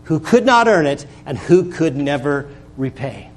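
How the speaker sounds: rising preacher's cry on "Unmerited favor"; full-force pulpit build then drop; preaching. Acted. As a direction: lift the congregation, then fall back.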